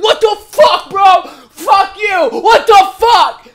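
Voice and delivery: high, loud mock outraged yell; hammed-up road-rage scream; imitated angry shout. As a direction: shout like furious drivers stuck behind. Angry drivers behind truck shouting.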